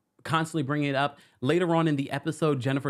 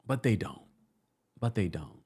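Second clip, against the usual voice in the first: normal talking voice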